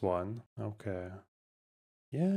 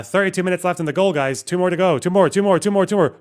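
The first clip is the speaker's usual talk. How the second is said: higher, louder rallying call, quick and insistent; urgent countdown; 7 semitones higher, 5 dB louder than usual. Real, not acted. Streamer urging viewers to hit the goal, urgent. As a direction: hype the crowd toward the finish.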